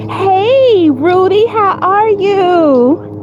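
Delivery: high, stretched-out sing-out hello; delighted greeting. Real, not acted. Streamer greeting a viewer joining late, delighted. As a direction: draw each greeting out high and eager.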